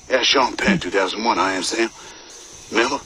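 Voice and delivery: normal talking voice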